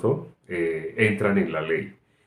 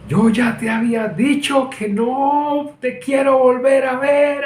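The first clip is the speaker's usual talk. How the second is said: high, loud, slow enraged scolding; furious shout; 6 dB louder, slower than usual. Retold or imitated. Father scolding his son off the bricks, furious shout. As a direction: yell it down at the child like a frightened father. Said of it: "en un tono muy, muy fuerte".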